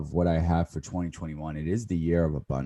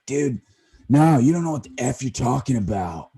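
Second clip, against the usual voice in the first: higher, louder, intense hardcore bro voice; Andy Frisella impression; 7 semitones higher, 6 dB louder than usual. Podcaster mocking his own diet talk, Andy Frisella impression. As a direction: go full intense motivational guy, loud and high. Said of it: "spot on imitation of Andy Frisella".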